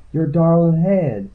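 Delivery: high, slow backwoods healer; high-voiced, lingering country endearment; entranced healer. A narrator's reading. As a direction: croon it high and drawn out. Healer searching him, in trance.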